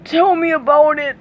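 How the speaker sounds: mildly strained reply; parched. A narrator's reading. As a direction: speak as if parched by heat. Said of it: "said Patrick, practically ... parched".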